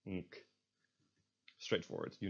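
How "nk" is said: "nk" as hummed NG closed by K; nasal into a K stop on "nk"; NK sound. Shown as a demonstration. Teacher isolating a Dutch combination, NK sound. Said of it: "N K is pronounced as nk".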